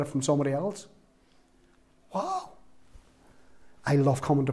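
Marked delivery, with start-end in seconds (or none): 2.1-2.5 incredulous wow. Real, not acted. Amazed reaction to the gossip.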